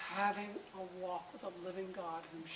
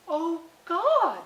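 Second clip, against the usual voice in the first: higher and much louder exclamation; exclaimed prayer; 8 semitones higher, 13 dB louder than usual. Retold or imitated. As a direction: burst out to God. Mom praying out loud, retold.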